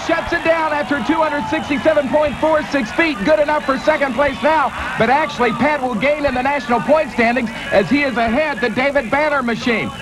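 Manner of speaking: high, energetic live race call; tractor pull announcer. Acted.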